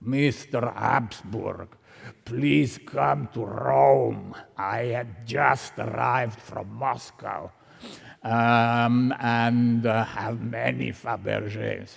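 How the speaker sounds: put-on slow, deliberate Russian-accented English; thick Russian accent. Russian caller inviting an expert to Rome, thick Russian accent. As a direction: imitate a heavily Russian-accented caller, drawing out the words. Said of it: "with a very thick Russian accent".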